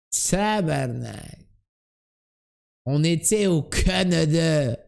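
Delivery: slow imitated Québécois lament; hammed-up Québécois dismay; Québécois accent. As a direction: stretch the vowels like a Québécois. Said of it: "quand tu imites les Québécois".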